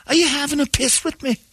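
very high, exaggerated mock-Glaswegian; mangled Scottish accent. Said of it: "I don't think you're even doing that right".

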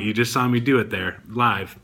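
ordinary talk, nothing marked

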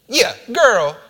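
high, loud, casual chiding; playful voice of someone well known; familiar, recognizable tone. Acted. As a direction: call out to a friend who should know you. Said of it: "said it in a tone ... sounded like Jesus".

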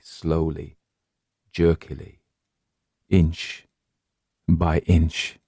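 halting, word by word; hushed, drawn-out suspense; suspenseful creeping pace. A narrator's reading. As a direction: leave long pauses between the words. Creeping movement described with suspense.

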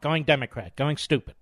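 usual speaking voice

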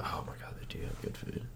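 soft breathy whisper of delight. Real, not acted. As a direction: whisper it like a secret craving. Excited aside about the restaurant.

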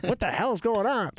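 a mother suddenly catching on; shocked mom. Retold or imitated. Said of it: "my mom was like".

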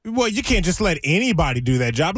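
usual speaking voice

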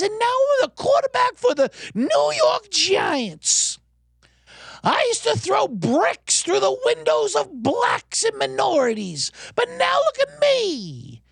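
high, slow mock Brooklyn braggart shouting; mock Italian-American nostalgia voice; hammed-up Brooklyn kid. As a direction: play the cheesy one-man show hero to the hilt. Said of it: "I could do that voice all night".

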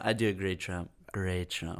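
Trump voice. Pretend.

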